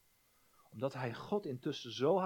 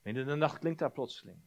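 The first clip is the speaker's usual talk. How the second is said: usual speaking voice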